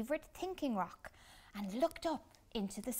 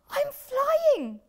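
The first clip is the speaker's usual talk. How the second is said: much higher and louder thrilled cry; delighted shout, much higher; excited; 14 dB louder than usual. Acted. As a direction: burst out with joy. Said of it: "said Flip-Flop excitedly".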